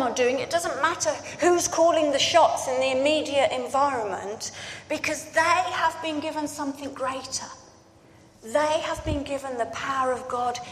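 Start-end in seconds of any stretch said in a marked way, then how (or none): none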